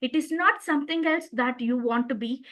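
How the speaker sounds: ordinary delivery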